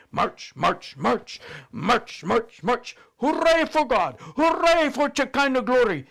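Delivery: high, loud marching cheer; loud hammed-up victory shout; cheering cry. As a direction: play it up into a big cheer. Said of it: "she cried".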